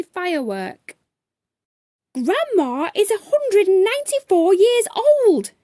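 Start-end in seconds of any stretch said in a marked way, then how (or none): 2.1-5.5 high, loud angry shout; little cookie screaming at the rude man; furious scream. A narrator's reading. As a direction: let it burst out like a firework. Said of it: "she screamed".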